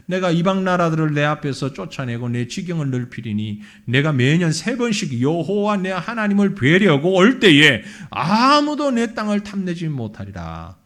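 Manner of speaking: loud, ringing scripture read. Acted. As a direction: proclaim the verse to the hall. Reads scripture aloud. (큰 소리로 낭독하는 성경 구절)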